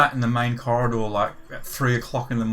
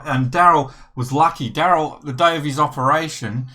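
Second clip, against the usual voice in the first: usual speaking voice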